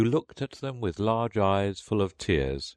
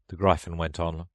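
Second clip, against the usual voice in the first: ordinary delivery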